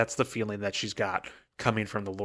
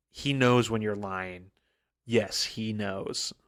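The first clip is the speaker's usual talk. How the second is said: slow ominous warning; slower than usual. Retold or imitated. Warning Vin not to lie.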